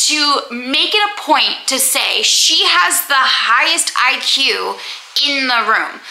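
usual speaking voice

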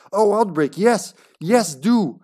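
excited approval. Real, not acted. Delighted at next scenario.

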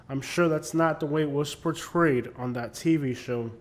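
ordinary talk

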